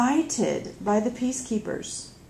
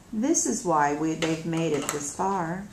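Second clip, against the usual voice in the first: ordinary delivery, nothing marked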